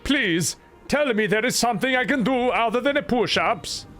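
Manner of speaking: high, sing-song fake Swedish accent; mock Swedish accent. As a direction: play an earnest Swede with a hammed-up accent. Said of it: "'cause I am a Swedish".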